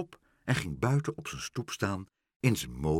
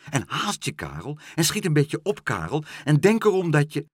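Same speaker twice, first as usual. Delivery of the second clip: urging; 6 semitones higher, 5 dB louder than usual. A narrator's reading. Pen urges Karel to hurry.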